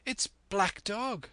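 high, urgent alarm; high-pitched cry of recognition; boy's cry. A narrator's reading. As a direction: raise the alarm in a high young voice. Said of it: "I cried".

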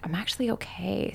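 breathy, hushed whisper. Retold or imitated. Whispering her realization to herself about her husband. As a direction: barely breathe the words out. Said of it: "it was a moment that I was like".

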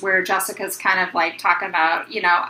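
normal talking voice

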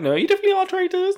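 high, fast squeaky accusation. Retold or imitated.

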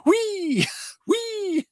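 put-on very high, playful kid's squeal; gleeful squeal. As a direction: squeal like a spinning toddler. Imitating Grogu spinning on chair.

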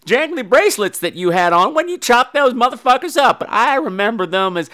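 high, innocent daughter voice; put-on sugary daughter; sweet daughter. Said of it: "she's just so sweet and everything".

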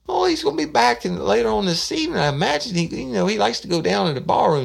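high, put-on voice of the woman on the phone; old woman's voice. Woman answering a prank phone call, high put-on voice.

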